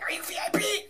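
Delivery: high-pitched, urgent mock-frantic question; frantic asking. Fan asking the next line, frantic high question. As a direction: blurt it up high, desperate to find the line.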